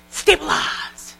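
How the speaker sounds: slow whispered stabilize; whispered mantra. Shown as a demonstration. Speaker showing consultants a calming word. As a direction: breathe the word out slowly. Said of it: "You're gonna go".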